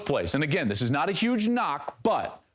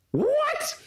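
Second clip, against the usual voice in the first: high, stunned disbelief; can't believe what you just saw; real amazement, pitched way up; astonished; 8 semitones higher, slower than usual. Real, not acted. Reacting to a great throw.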